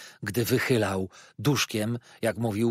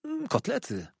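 small, hesitant confirmation; lightly voiced shy young guest; timid boy. A narrator's reading. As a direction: confirm meekly after a little hum. Boy quietly confirms the dish.

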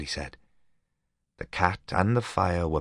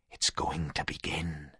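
hushed; 7 dB quieter than usual. Acted. Boys spooked at window.

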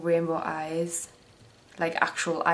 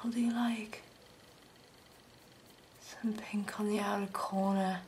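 whispering; 8 dB quieter than usual. Real, not acted. Muttering while doing makeup.

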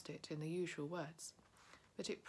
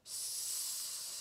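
unvoiced creature hiss; long sibilant hiss. A narrator's reading. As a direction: hiss like Gollum. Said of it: "said Gollum".

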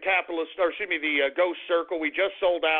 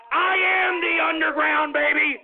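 bombastic sign-off; 6 semitones higher, 3 dB louder than usual. Real, not acted. Radio host signing off, then scolding dog, hollering. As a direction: belt it out high and stretch the words.

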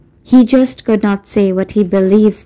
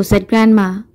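plain reading voice

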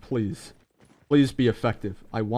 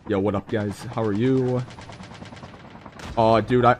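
ordinary delivery

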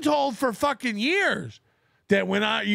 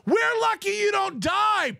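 ordinary talk, nothing marked

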